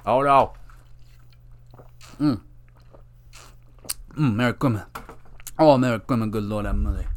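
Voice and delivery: strained voice after choking; choked on water. Real, not acted. Podcaster recovering from a bad sip of water, choking. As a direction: squeeze the words out past water gone down wrong. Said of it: "I choked on water".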